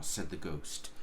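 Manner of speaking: ordinary delivery, nothing marked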